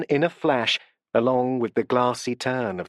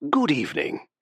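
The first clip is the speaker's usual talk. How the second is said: curious rumbling voice. A narrator's reading. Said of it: "in a curious rumbling voice".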